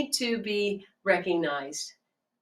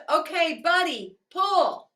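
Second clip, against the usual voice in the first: farmer calling horses; 10 semitones higher than usual. Acted. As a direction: holler at the team to pull. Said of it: "And then he says".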